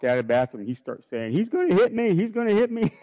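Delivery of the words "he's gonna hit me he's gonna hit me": high, loud imitation of a tattling kid; child's mock alarm. Son leaving the bathroom warns the restaurant, mock panicked cry. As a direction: cry out like a little boy telling on Dad.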